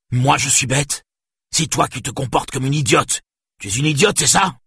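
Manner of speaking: high, incensed scolding; angry retort. A narrator's reading. Father outraged at being insulted by his daughter, angry. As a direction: throw it back furiously.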